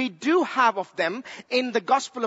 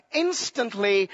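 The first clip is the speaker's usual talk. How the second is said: normal talking voice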